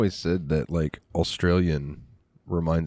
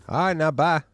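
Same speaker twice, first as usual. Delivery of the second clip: higher-pitched imitated Southern farewell; local Southern accent; 7 semitones higher than usual. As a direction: put on a down-home Southern accent. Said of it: "a lot of people down here".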